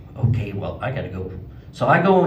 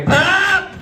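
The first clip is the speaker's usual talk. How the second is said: high, louder startled cry; startled yelp; 6 dB louder than usual. Retold or imitated. Snappers pop under him, a startled high yelp.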